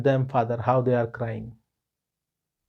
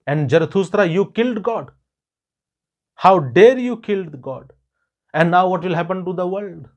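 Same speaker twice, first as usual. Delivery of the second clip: indignant accusation, higher and louder at its peak; angry; 4 semitones higher, 4 dB louder than usual. Acted. Villagers accuse Zarathustra of killing God, angry. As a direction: build to an outraged challenge. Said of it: "very angry".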